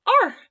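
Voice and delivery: high, loud shriek; a startled bird's loud scream. A narrator's reading. Little bird screams at the bear, loud shriek. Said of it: "screamed a tiny little feathered thing".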